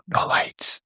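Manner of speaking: put-on hushed kids' answer; whisper. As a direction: breathe it without voice. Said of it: "They go".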